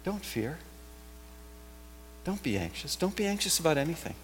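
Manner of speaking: soft, gentle reassurance; reassuring. Shown as a demonstration. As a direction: speak it softly, like comforting a frightened friend. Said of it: "spoken in a tone of reassurance".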